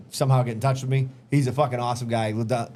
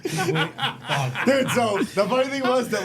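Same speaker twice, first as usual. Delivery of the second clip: laughing; 7 semitones higher, slower than usual. Real, not acted. Laughing at the nickname.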